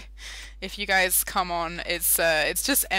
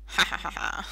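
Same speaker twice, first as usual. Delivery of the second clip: mock laugh.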